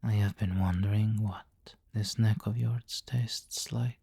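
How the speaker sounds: ordinary talk, nothing marked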